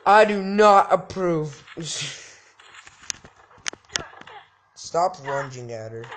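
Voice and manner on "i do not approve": louder, slow, stern; hammed-up stern disapproval. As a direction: pronounce judgment gravely. Voicing a stern character.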